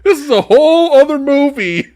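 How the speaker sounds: can't believe the movie, laughing it out; laughing disbelief. Real, not acted. Reviewer laughing at the twist, high and loud.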